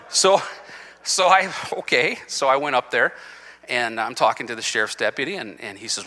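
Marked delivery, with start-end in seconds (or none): none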